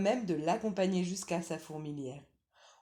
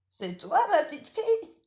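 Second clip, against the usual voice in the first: lightly higher, louder granny voice; old grandmother; 13 semitones higher, 10 dB louder than usual. Acted. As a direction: call out as a drowsy old granny from bed. Grandmother asks.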